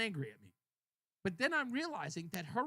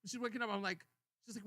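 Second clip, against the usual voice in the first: ordinary talk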